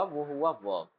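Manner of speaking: ordinary talk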